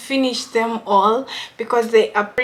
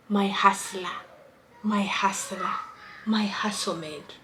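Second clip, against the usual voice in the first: soft whispered caption; whispered quote; 2 semitones lower, 9 dB quieter than usual. Retold or imitated. Quoting her man's caption, whispered. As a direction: breathe the caption quietly. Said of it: "then he put a caption".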